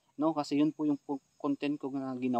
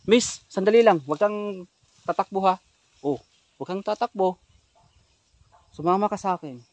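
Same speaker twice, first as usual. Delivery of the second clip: calling out; 5 semitones higher, 7 dB louder than usual. Real, not acted. Calling after fleeing woman.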